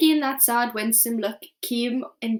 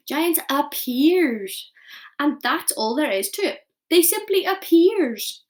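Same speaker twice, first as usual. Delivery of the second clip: slow, louder, wondering giant voice; slow giant explaining; 4 semitones higher, 6 dB louder, slower than usual. Acted. Giant explaining to Sophie, slow and grand. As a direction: spell it out grandly.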